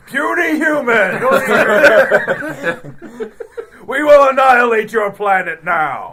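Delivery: slow, very loud, high laughing alien roar; booming arrogant alien overlord. Acted. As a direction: bellow it like a conquering alien warlord, cracking up.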